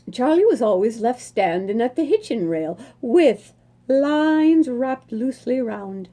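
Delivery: ordinary delivery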